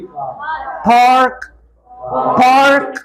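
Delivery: American "पार्क", drawn out with a full R; American pronunciation. Shown as a demonstration. Teacher shows Americans' R, "पार्क" in slow American style. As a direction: say "पार्क" slowly the American way, R fully sounded. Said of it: "आर को अच्छी तरह से produce".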